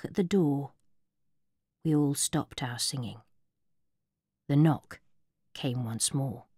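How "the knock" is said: ordinary delivery, nothing marked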